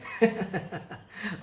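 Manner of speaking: a burst of high laughter. Real, not acted. Laugh after Yamraj's complaint.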